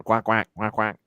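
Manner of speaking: playful quacking like a duck; duck quacks. Pretend. Cheering his Ducks bet.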